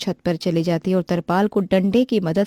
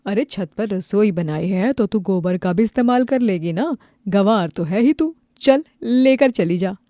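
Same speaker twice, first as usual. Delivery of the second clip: insulting neighbour; 3 dB louder than usual. Acted. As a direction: rub it in and send her off. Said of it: "पड़ोसन की बात पायल को काफी ज्यादा बुरी लगती है". (तिरस्कार से हुक्म देती पड़ोसन)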